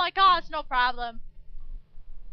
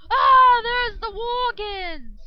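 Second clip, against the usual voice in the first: 1 alarmed cry; 7 semitones higher, 12 dB louder, much slower than usual. Real, not acted. Alarmed at attacking Worgens.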